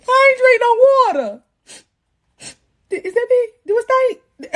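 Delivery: very high, tearful whine; mock panicked wail. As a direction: wail it in hammed-up distress. Retelling her panic at the fluid.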